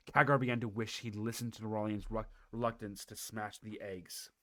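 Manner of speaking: ordinary delivery, nothing marked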